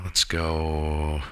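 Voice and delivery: normal talking voice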